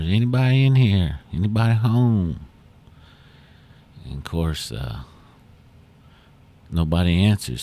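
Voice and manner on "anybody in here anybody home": calls out loud and high into the shack; raised voice, calling into an empty room; hollering. Retold or imitated. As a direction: call out to see if anyone is home. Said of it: "he hollered".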